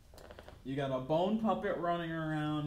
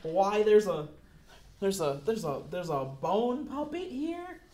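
loud, raised interrogation; threatening demand; 6 semitones higher, 8 dB louder than usual. Acted. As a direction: bark the questions at him. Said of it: "He pulls your gun immediately".

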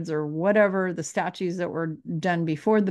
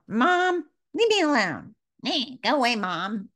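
much higher, whiny and dismissive; annoyed brush-off; 5 semitones higher than usual. Retold or imitated. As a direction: push Mom away like a sulky kid. Rude brush-off to Mom.

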